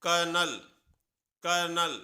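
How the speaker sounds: कर्नल with an r and no l sound, said twice; correct pronunciation. Shown as a demonstration. Teacher models colonel as कर्नल. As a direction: model कर्नल clearly for the class. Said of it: "सही pronunciation है".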